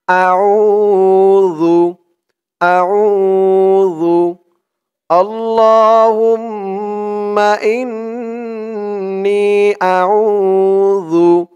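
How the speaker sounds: slow, drawn-out recited Arabic; model recitation. Shown as a demonstration. Teacher models the word for viewers to repeat, slow recitation. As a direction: stretch every syllable for them to copy. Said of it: "আগে ধরুন আরবিটা".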